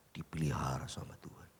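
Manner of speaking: soft whispered close; preacher whispering God's care to the congregation; whispered assurance. Acted. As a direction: drop to a confiding whisper.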